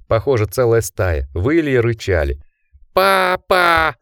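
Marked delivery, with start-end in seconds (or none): 2.9-3.9 much higher, loud scream for dad; horrified cry. A narrator's reading. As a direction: shriek it in horror. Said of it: "с ужасом прокричал Ричард".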